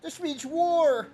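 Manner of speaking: angry villager rallying the clan; slow war cry. Acted. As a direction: declare war, drawn out.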